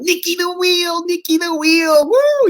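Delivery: very high, slow, whooping cheer; imitating screaming. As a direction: whoop it up like a thrilled passenger. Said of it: "He's just screaming".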